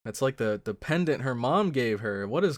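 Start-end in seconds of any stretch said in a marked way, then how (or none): none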